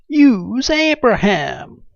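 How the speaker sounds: high, slow, loud put-on Southern drawl; mock Southern accent. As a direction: stretch it out in a big hammed-up Southern accent. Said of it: "speaking in an accent like that".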